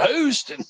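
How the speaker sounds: Houston drawled high like a country Texan; Texas drawl. Shown as a demonstration. Friends greet anything Houston with a drawled Houston. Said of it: "we just go".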